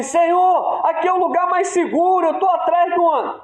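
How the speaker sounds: high-pitched urgent protest to an officer; soldier in battle. Acted. Soldier arguing with his captain from cover, high urgent cry. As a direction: plead your case over gunfire. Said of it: "um dos soldados fala assim".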